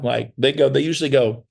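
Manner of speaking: ordinary talk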